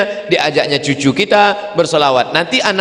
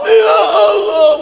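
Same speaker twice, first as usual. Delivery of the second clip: high, drawn-out wail; weeping pilgrim's cry; tearful cry; 12 semitones higher, 4 dB louder, slower than usual. Retold or imitated. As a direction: sob it out slowly.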